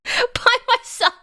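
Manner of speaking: high, slow and laughing with a light singsong; laughing over the words, slow and high. Real, not acted. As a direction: giggle it out, drawn out and high. Laughing at being alone.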